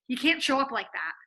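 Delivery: ordinary talk, nothing marked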